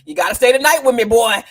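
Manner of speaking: loud, pushy woman voice; role. Retold or imitated. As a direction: demand he stay, loudly. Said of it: "she trap 'em".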